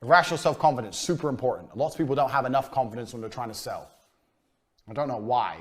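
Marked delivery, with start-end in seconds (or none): none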